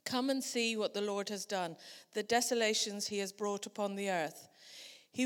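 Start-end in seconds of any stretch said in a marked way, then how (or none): none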